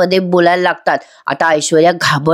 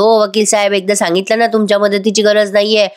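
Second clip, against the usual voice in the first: ordinary talk, nothing marked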